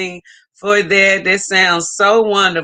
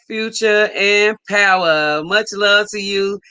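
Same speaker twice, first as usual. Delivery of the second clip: ordinary talk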